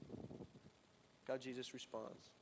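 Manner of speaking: slow hushed emphasis for the congregation; slow, hushed repeat. Shown as a demonstration. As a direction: let it sink in slowly and quietly.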